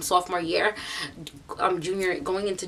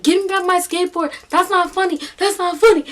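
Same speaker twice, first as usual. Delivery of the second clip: higher-pitched imitation of an upset boy; 9 semitones higher, 3 dB louder than usual. Boy wanting his skateboard back from her sister, upset. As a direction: protest in a raised, mock-upset voice. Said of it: "he starts getting upset".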